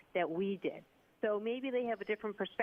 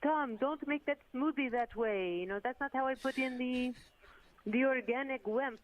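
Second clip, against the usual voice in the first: put-on Gisele Bundchen Brazilian accent; 5 semitones higher than usual. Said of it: "if only I could do a Brazilian accent".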